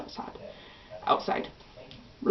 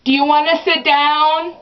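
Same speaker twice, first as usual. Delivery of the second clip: mom voice; 6 semitones higher, 4 dB louder than usual. Retold or imitated. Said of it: "my mom goes".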